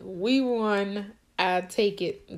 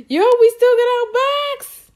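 high, loud relieved cry; excited yell; 11 semitones higher, 8 dB louder than usual. Real, not acted. Player cheering that her box survived, loud and high.

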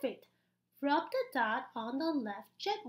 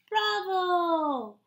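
louder bright bravo; cheering bravo; 4 semitones higher, 6 dB louder than usual. Acted. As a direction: cheer the child on. Loud praise.

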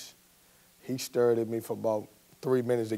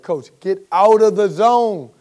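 loud, higher, drawn-out plea; raised-voice urging, stretched out; shouting to coach; 8 semitones higher, 11 dB louder, slower than usual. Retold or imitated. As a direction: holler it slowly across a noisy sideline. Player shouting back at yelling coach.

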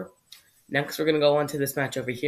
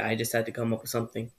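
usual speaking voice

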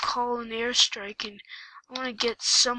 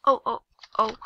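ordinary delivery

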